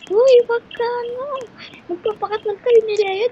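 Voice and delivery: high, loud excited yelling; excited shout. Acted. Josh yelling at George about marrying Leia, high fast shout. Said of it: "At sabi niya".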